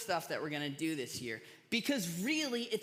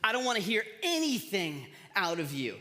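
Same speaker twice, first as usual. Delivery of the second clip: stern mother; 3 semitones higher, 5 dB louder than usual. Retold or imitated. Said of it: "she goes".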